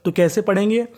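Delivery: normal talking voice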